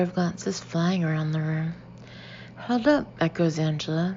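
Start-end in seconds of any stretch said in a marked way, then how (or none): 2.6-3.1 higher questioning echo with an edge; pointed echo. A narrator's reading. Angela echoing Becky's excuse for Luke, pointed echo. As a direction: echo it back as a doubting question. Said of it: "a tone to her voice".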